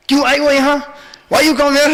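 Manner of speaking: high, loud, blunt questioning; rude demand. Shown as a demonstration. Little Krishna to the elderly gopi, rudely. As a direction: fire it out rudely, pitched well up. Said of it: "like, so rudely".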